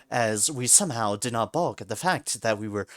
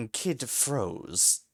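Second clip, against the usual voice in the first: plain reading voice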